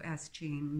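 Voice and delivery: plain reading voice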